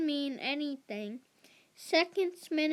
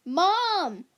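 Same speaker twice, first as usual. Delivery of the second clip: a sharp high outburst of protest, much louder; dismayed cry; 7 semitones higher, 10 dB louder than usual. A narrator's reading. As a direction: cry out at mom in disbelief.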